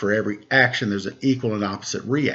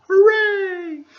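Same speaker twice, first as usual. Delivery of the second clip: cheerful hooray; 5 dB louder than usual. Acted. Cheering the baby's birth.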